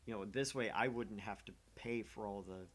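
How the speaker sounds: usual speaking voice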